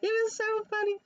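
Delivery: high squeaky laugh-cry. Real, not acted. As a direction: wheeze it out in tears of laughter. Cracking up retelling it.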